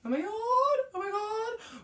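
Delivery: high, slow, tearful-sounding squeal of joy; overjoyed cry. Real, not acted. Finding her old favorite shirt, overjoyed cry. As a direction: let it break with delight.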